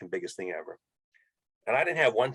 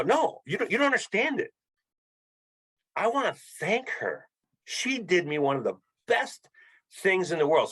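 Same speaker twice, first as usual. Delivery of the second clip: much higher, pushing the point hard; insist, nearly pleading, that they don't understand; emphatic insistence; 9 semitones higher than usual. Retold or imitated. Telling staff he wants to thank the lady, emphatic and high.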